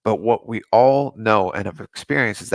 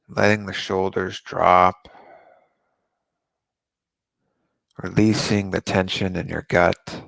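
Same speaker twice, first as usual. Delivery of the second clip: guided meditation. Acted. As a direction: ease them into calm breathing, unhurried.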